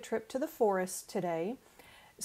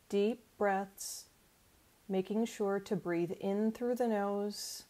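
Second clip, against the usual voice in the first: calm meditation voice with long pauses; guided imagery. Acted. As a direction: lead them gently through a relaxation exercise. Leads guided forest imagery.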